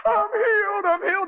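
high, tearful cry of joy; retelling her weeping joy. Retold or imitated. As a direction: sob it out happily, voice up high. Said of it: "she's crying and saying".

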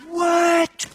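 high, loud "what" of disbelief; astonished. Retold or imitated. Hunter hearing the turkey answer, high loud astonishment. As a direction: yelp it in amazement.

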